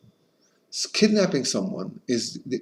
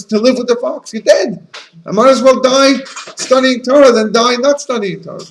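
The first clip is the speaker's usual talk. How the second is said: much higher, louder fervent sermon voice; impassioned preaching; 11 semitones higher, 9 dB louder than usual. Acted. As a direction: Rabbi Akiva defending Torah with full passion. Rabbi Akiva's impassioned teaching.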